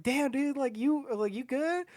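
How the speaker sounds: high-pitched imitation of puzzled friends; concerned friends. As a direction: pitch it up, checking on a tripping buddy. Voicing everyone's concerned reaction.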